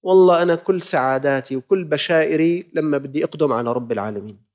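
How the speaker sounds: ordinary delivery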